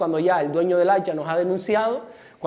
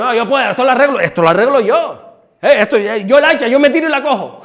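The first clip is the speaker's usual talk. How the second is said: self-sufficient men; 3 semitones higher, 6 dB louder than usual. Acted. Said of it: "Pero los hombres somos".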